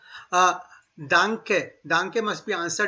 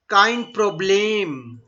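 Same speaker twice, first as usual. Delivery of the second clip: slow Kein Problem, each sound clear; drawn out for learners; deliberate demo; slow model phrase; much slower than usual. Shown as a demonstration.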